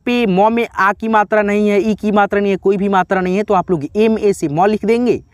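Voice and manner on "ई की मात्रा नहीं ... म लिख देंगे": ordinary talk, nothing marked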